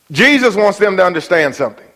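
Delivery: usual speaking voice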